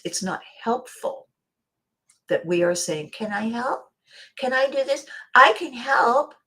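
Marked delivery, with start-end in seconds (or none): 3.1-6.3 put-on higher, eager little child pleading to help; a toddler begging to join in. Small child asking to help.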